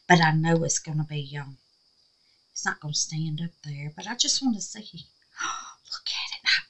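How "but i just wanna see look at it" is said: a gasp then whispered look; awed whisper. Real, not acted. Peeking at the craft for viewers, awed whisper. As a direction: hush it in wonder. Hushed wonder at result.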